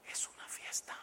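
unvoiced conspiratorial whisper; whispered aside. Shown as a demonstration. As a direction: let them in on the secret. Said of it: "whispered aside to audience". (susurrando al público)

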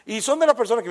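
usual speaking voice

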